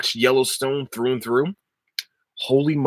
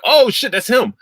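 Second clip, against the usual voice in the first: higher, startled crowd reaction; excited recognition; 12 semitones higher, 4 dB louder than usual. Retold or imitated. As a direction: act out viewers suddenly spotting him. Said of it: "people were like".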